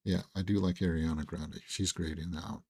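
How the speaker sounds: strained, worn-out voice; a voice that's giving out; losing voice. Real, not acted. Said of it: "I am losing my voice".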